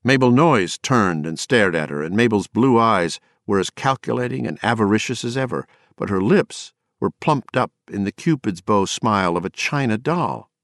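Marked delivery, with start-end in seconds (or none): none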